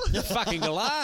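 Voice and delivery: high, exaggerated Aussie accusation; cartoon Australian wife. Wife accusing husband, in a high cartoonish Australian accent. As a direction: throw it out as a hammed-up Australian wife. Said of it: "you married an animated koala from the eighties".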